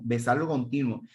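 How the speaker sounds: normal talking voice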